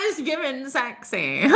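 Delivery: high, slow, laughing delivery; amused, high-pitched drawl breaking into laughter. Real, not acted. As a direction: draw it out through giggles. Laughing at own teasing remark.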